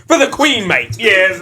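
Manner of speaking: high, loud British cheer; mock British accent. Joining a joke about British rap, mock British accent. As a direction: exclaim it in a hammed-up British accent. Joking imitation of British speech.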